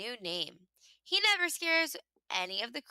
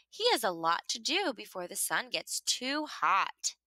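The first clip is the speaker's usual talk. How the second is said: ordinary delivery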